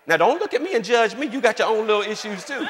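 playful loud teasing. Real, not acted. Teasing the congregation.

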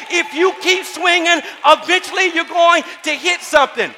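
loud, high impassioned sermon; preaching at full force. Acted. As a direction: build to a shouted preacher's climax. Preaching climax.

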